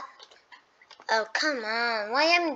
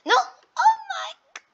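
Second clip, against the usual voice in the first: slow, high oh my; high dismayed cry; 4 dB louder, slower than usual. Real, not acted. Gamer wailing as the fight turns against him. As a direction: wail it in alarm.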